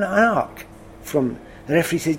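usual speaking voice

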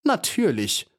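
high, indignant-sounding exclamation; called out. A narrator's reading. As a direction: cry it out as the beetle poet. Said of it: "rief Alois". (hoher Ausruf)

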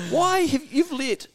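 high, disbelieving rant; mock exasperation. To a friend, mocking news addicts in a high incredulous rant. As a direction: squeal at them in mock outrage. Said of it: "I'm like".

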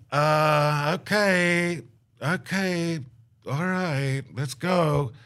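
high, drawn-out reluctant okays; retold uneasy going-along; nervous agreement. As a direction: talk yourself into it, with pauses between each word. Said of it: "I look at Leslie nervously".

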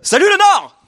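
high, loud shout to the crowd; shouted greeting. Acted. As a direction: call out to the whole room.

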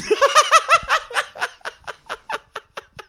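very high-pitched trailing laugh; high giggle. Real, not acted. Host pictures a big E blues jam, high giggling laugh. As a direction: squeal with laughter until out of breath.

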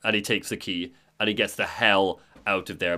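ordinary delivery

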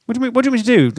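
ordinary talk, nothing marked

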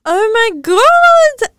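loud, high, drawn-out exclamation; excited outburst. Real, not acted. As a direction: burst out with delight, stretching the words. Excited discovery of French in Belgium.

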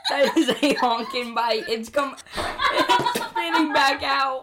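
high, giggling commentary; can't stop laughing while talking; laughing through words. Real, not acted. Laughs at huge bite.

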